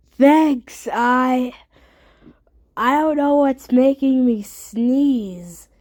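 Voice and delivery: ordinary talk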